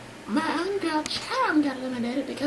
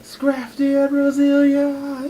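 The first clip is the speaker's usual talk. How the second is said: drawn-out announcer intro building louder; show announcer; 7 dB louder, slower than usual. Acted. Introducing the hosts live from Tokyo, big announcer voice. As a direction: stretch it out, building to the hosts' names.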